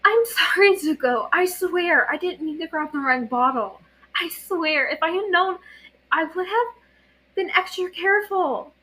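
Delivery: put-on high, tearful pleading boy voice; guilty young monk. A narrator's reading. Aang apologizing to Zuko, pitched high and guilty. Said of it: "gray eyes wide and lips trembling".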